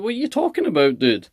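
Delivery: high-pitched, incredulous comeback; incredulous retort. Retold or imitated. Retelling his baffled retort on phone.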